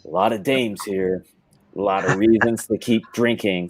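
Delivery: low, terse noir tough guy; Frank Miller. Acted. Host parodies a noir author at a baby shower, low impression. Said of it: "read it in his voice".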